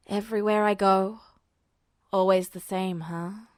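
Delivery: put-on a low, weary boy's voice; tired and resigned, pitched low; Duo's voice. A narrator's reading. Duo voiced, lower, own language.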